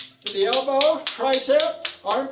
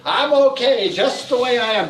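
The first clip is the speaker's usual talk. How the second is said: louder, higher, emphatic; affirmation called out; 6 semitones higher, 6 dB louder than usual. Shown as a demonstration. Teacher leads the group affirmation, loud emphatic call. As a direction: proclaim it for everyone to repeat.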